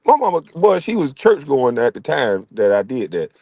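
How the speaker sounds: usual speaking voice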